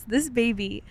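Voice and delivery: normal talking voice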